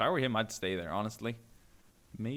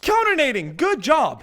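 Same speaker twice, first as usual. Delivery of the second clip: loud, much higher praise; excited cheer; 14 semitones higher, 12 dB louder than usual. Real, not acted. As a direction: cheer them on. Cheering the counter grenade.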